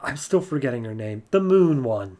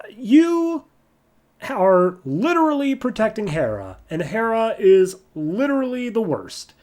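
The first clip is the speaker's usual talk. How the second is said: higher, sneering villain voice; a hint of Soraka; 8 semitones higher than usual. Soraka accusing Wonder Woman of protecting Hera, high scornful voice. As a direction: spit the words at her.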